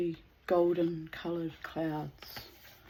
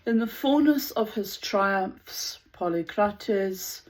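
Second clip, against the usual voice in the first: louder, measured storytelling voice; story narration; 2 semitones lower, 7 dB louder than usual. Acted. Narrator reading the tale of Polycrates aloud, measured and louder. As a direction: read the myth out with weight. Reads a Greek myth.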